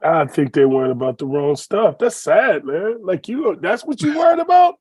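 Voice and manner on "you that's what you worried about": high, loud disbelieving shout; incredulous shout. Real, not acted. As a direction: yell it in disbelief. Disbelief at the owner's priorities.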